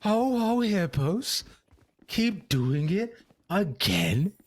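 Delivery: slow, stretched, sing-song marvel; drawn-out amazement. Real, not acted. Caster marveling to co-caster at the tied score, slow and stretched. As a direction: draw each word out in disbelief.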